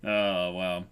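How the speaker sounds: ordinary delivery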